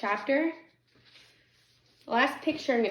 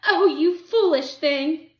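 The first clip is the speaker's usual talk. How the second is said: loud, high, slow lament; dismayed cry; 7 semitones higher, 9 dB louder, slower than usual. A narrator's reading. Old woman to her dead cat, dismayed cry. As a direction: wail it out over the loss. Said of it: "cried when she set eyes on the dead cat".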